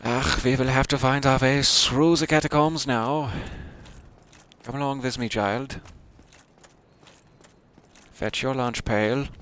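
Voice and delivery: a hint of a turn of the century German accent; a lightly voiced German grandfather; old German accent. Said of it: "turn of the century German".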